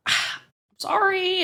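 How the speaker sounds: high, drawn-out mock apology; sheepish apology. Nancy caught by her lover, imitated sheepish apology. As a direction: squeak out a guilty sorry, slowly.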